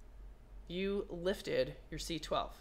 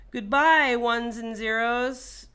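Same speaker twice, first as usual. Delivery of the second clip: loud, slow and grand; slow, theatrical send-off; mock farewell; 4 semitones higher, 10 dB louder, slower than usual. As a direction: bid the data a dramatic goodbye. Farewell to data while shorting chip.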